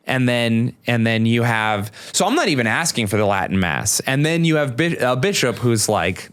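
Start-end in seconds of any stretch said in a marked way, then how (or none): none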